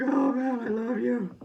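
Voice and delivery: high, loud mock-tearful gushing; imitating an emotional boxer, pitched high and loud; imitating crying boxer. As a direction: ham up a weepy declaration of love. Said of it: "Berlanga was crying and all".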